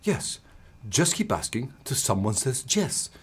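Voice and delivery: put-on soft Spanish accent. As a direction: imitate the mentor patiently repeating himself. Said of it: "He says".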